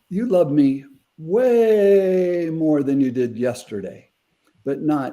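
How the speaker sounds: "way" drawn out long and high; emphatic retold declaration. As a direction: stretch WAY out, then glide slowly down through the rest. Retells daughter's emphatic declaration of love.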